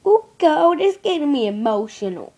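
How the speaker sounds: a mock high squeal on Oh God, loud and overdone; hammed-up girly wail. Vlogger mocking himself as a girl in a wig, a loud mock wail. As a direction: ham up a weepy girl in a wig. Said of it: "put a wig on ... be like".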